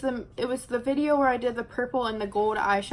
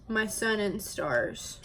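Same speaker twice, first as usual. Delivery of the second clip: ordinary talk, nothing marked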